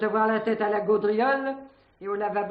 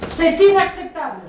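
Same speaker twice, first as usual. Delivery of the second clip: indignant outburst; 5 semitones higher, 8 dB louder than usual. Acted. Indignant lodger protests the search.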